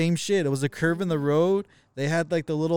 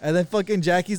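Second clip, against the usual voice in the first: ordinary talk, nothing marked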